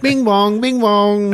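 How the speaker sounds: high singsong doorbell chime; imitated doorbell. Doorbell ringing in a bloody room, imitated chime. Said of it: "the fucking doorbell rings".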